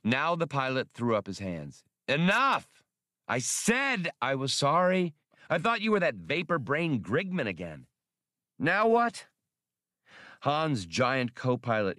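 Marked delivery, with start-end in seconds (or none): 2.1-7.9 raised, exasperated pilot voice; fed-up Han. Acted. 8.6-9.3 high, sharp impatient question; short-tempered pilot; annoyed captain; irritated Han. Acted.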